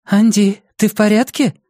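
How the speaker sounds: worried woman. Acted. Julia worried for Andi.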